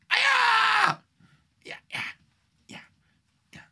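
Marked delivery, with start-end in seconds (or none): 0.1-1.0 high shrieked ouch; loud mock cry of pain; sharp pained yelp. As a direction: yelp like you've been hurt.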